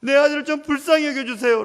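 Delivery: loud, high desperate plea; father begging Jesus at full voice; father crying out. Retold or imitated. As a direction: shout your plea for your son. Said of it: "라고 외치는".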